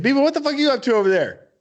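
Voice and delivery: a quick jeering call; retold yell. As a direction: snap it out across the gym. Retold yelling at cousin.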